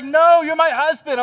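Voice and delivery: mock melodrama, she won't leave him; wife's dramatic refusal. Wife refuses to leave her husband, hammed-up and loud.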